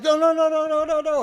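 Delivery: imitated panicky yell, high and loud; frantic protest. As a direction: rattle out a high, loud refusal. Imitates buddy's frantic objection.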